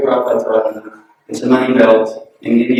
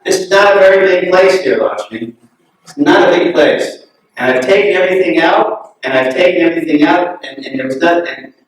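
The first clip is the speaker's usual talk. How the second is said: frustrated outburst; 6 semitones higher than usual. Retold or imitated. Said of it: "he kind of got really frustrated".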